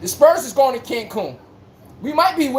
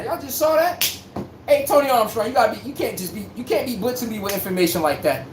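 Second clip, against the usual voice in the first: ordinary talk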